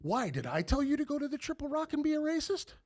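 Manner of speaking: high, pointed retold challenge; throwing the question back at him; confronting challenge. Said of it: "I go".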